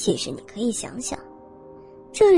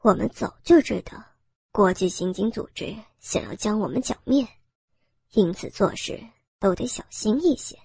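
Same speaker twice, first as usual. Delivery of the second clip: low, smug gang boss; cold sneer; 4 semitones lower than usual. Acted. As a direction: drop the voice low and gloat coolly. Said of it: "头目冷笑着说道". (头目低沉冷笑)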